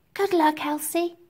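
put-on high little-girl voice; Nancy. A narrator's reading. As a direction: wish a friend luck as a small child. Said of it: "said Nancy".

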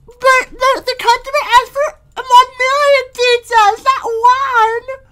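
very high, crying cartoon lady; panicking Screen Lady. Screen Lady panics about making pizza, high crying wail.

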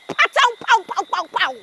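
high, punchy mouth-made shots; rapid imitated gunfire bursts; imitated gunshots. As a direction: fire off gunshot noises with the voice. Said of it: "I heard patow".

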